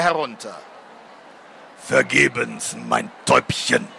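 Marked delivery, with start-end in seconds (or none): 1.8-3.9 low, slow, murmured demon voice; softly murmuring demon. A narrator's reading. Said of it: "raunte er sanft".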